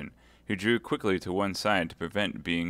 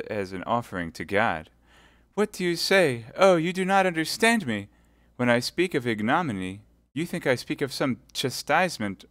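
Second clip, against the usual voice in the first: high, pleading martyr; wounded innocence; 3 semitones higher, 4 dB louder than usual. Acted. Milady pleading her innocence to Felton. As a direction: protest innocence with a smile.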